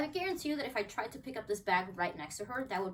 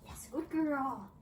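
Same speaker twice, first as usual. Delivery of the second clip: slow praise; 2 semitones higher, much slower than usual. Real, not acted. Trainer slowly praising her dog.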